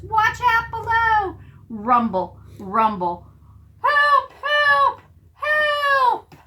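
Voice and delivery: very high, loud cries for help; panicked yelling. Acted. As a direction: squeal for help as the ball tumbles. Prairie dogs flee falling ball.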